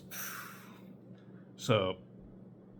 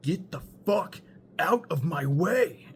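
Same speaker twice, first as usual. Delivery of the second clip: slow, high, loud mock shout; angry yell; 7 semitones higher, 5 dB louder, slower than usual. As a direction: bark it out like a tripping guy losing it. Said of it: "Looks me dead in the face with angry eyes".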